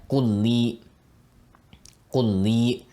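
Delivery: kulli with the lams merged into one doubled l; Arabic lam fusion. Shown as a demonstration. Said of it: "these lams are actually fusing together".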